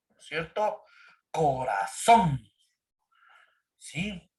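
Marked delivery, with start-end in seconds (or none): 1.3-2.4 "Corazón" drawn out slowly, weight on "zón"; stress on the last syllable. Shown as a demonstration. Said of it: "la fuerza de voz está en la última sílaba". ("Corazón" muy lento, con la fuerza en "zón")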